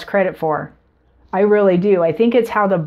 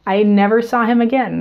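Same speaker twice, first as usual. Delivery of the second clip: ordinary talk, nothing marked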